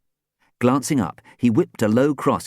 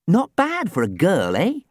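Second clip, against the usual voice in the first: playful, higher teasing tone; 11 semitones higher, 3 dB louder than usual. A narrator's reading. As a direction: tease him a little. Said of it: "she observed teasingly".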